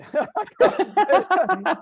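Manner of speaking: loud high burst of laughter; laughing hard at the boss's quip; big laugh. Real, not acted. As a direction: let out a big real laugh.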